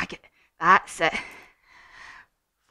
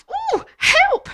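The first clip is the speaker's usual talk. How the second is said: very high, loud hooty shriek; frightened owl; 10 dB louder than usual. Acted. Owl seeing the Gruffalo, panicked squeal. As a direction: squeal for help in hammed-up panic. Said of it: "Owl said".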